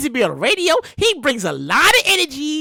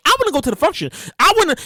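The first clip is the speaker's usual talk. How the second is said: ordinary talk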